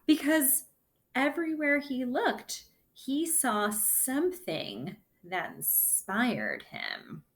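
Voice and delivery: lively storybook read-aloud; reading to children. Acted. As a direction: read the story to the class with bounce. Reads the picture book aloud.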